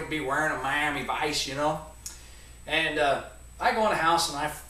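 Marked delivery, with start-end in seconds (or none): none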